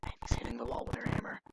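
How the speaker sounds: whispered aside. Real, not acted. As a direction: whisper it under the action. Aside while the scene plays.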